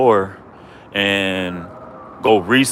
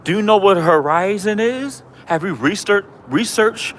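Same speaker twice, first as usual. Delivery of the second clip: high, mocking sing-song challenge; mock-high taunting of a rival; 10 semitones higher than usual. Real, not acted. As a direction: squeak the question right at him in mockery. Taunting the flat earth critic.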